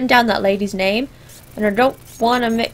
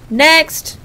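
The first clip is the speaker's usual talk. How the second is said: raised, higher call for the next person; impatient summons, pitched up; calling the queue; 5 semitones higher, 4 dB louder than usual. Acted. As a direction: call out firmly to whoever is waiting. Calls again to the queue.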